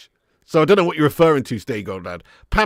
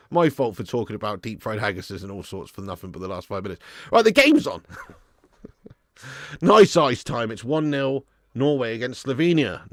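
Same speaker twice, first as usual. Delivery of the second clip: ordinary talk, nothing marked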